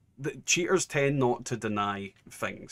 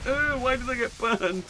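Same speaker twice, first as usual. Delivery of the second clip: high-pitched put-on whine; mock complaining; 13 semitones higher, 3 dB louder than usual. Streamer imitating banned dupers who complain, mock high-pitched whine. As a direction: imitate a complainer in a much higher voice. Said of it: "they're the ones that are complaining".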